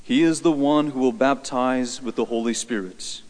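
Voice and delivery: slow, measured liturgical reading; solemn reading from the lectern; Gospel reading. Acted. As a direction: proclaim scripture slowly, with deliberate pauses. Reads the Gospel at Mass.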